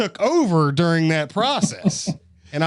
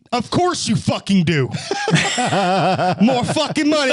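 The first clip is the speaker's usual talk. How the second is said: mock angry rant; 6 semitones higher, 3 dB louder than usual. Mock rant of rude cashier.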